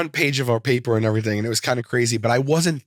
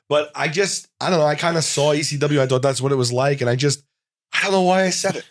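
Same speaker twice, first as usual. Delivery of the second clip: usual speaking voice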